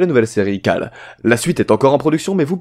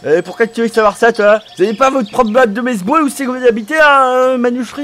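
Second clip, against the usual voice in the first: high, hostile rustic voice; suspicious villager; 9 semitones higher, 4 dB louder than usual. Acted. Villager questions the reporter, high and suspicious. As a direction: grill the reporter warily.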